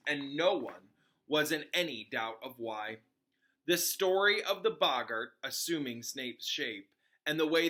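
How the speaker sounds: steady read-aloud storytelling voice; story narration. Acted. Reader narrating a book chapter to her audience, storytelling. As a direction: read the chapter to listeners, even and clear. Reads Harry Potter aloud.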